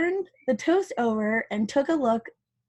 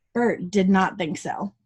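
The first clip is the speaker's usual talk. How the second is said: ordinary delivery, nothing marked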